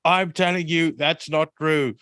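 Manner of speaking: high, slow preachy teacher voice; preacher teacher quote. Retold or imitated. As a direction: lecture the kids like a preacher. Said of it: "he looks up and he says".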